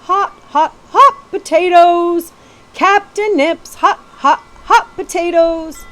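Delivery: loud, high, drawn-out street vendor cry; calling out wares. A narrator's reading. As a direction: hawk hot potatoes to the crowd, slowly and loud. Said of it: "began calling out to the passing crowd".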